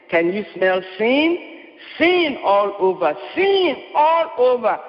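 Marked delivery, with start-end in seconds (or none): none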